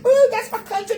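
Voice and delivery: high, loud laughing delight; laughing pride. Real, not acted. Cheering Jamaica's athletes, a high laughing proud cry.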